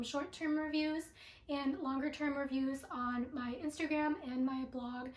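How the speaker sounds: ordinary talk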